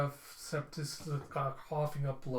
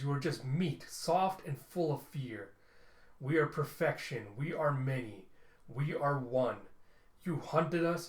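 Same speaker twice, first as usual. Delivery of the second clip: cold, contemptuous robot warlord addressing humans; a villainous robot leader's speech; menacing machine overlord. Acted. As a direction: speak as a machine that despises flesh.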